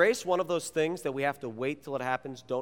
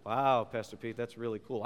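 mock teen enthusiasm, softer and lower; unimpressed teenager; 4 semitones lower, 4 dB quieter than usual. Teenager humoring the youth pastor, unimpressed. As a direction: feign interest like a bored teen. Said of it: "I work with teenagers".

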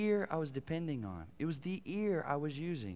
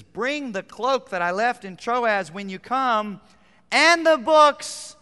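slow, loud, higher plea for the cloak; pleading Paul; 8 semitones higher, 11 dB louder, slower than usual. Acted. Apostle begging a friend for his cloak, slow loud plea. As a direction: voice the cold apostle begging, drawn out. Paul's plea voiced by preacher.

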